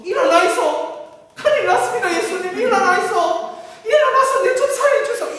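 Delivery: high, loud Gyeongsang-dialect panic, laughing. Retold or imitated. Disciple shaking Jesus awake, panicked Gyeongsang dialect with laughter. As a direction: shout in Gyeongsang dialect to wake him. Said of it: "경상도시로 부르고".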